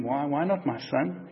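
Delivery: soft, slow and gentle. Retold or imitated. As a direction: ask it kindly, like a patient teacher. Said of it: "he was gentle with him".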